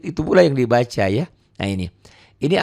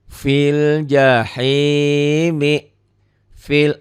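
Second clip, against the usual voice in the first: slow tajwid chant; Quran recitation; 4 semitones higher, 5 dB louder, much slower than usual. Shown as a demonstration. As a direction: draw the words out like a reciter. Recitation example. (lantunan tartil pelan)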